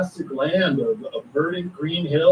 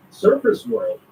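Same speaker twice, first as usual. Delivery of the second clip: puzzled thought to himself; 7 semitones higher than usual. Retold or imitated. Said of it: "And he thinks to himself".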